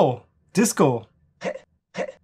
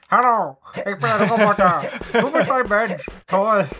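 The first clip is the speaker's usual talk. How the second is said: high mechanical robot voice with a laugh; 8 semitones higher, 3 dB louder than usual. Retold or imitated. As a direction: mimic the game's clunky robot voice. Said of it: "eine Roboterstimme nachzumachen". (Roboterstimme, hoch und abgehackt)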